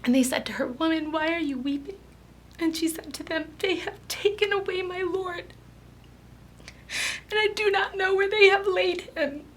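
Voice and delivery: high-pitched, tear-choked reading; crying while reading. Real, not acted. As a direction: push on through real tears. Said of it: "can't read this story without crying".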